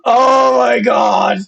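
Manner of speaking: slow, loud, strained cry of disbelief; shocked outcry. Retold or imitated. Viewer reacting to the scene, mock horrified slow wail. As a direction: wail it out in horror, dragged slow. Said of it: "You're like".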